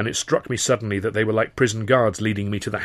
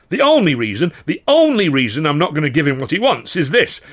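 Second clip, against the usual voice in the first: loud, higher contemptuous rant; 6 semitones higher, 7 dB louder than usual. A narrator's reading. The governor ranting about the theatre manager, loud and scornful. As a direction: fume at the absent prig, loud.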